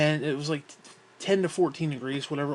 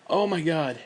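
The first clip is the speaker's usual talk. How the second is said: ordinary talk, nothing marked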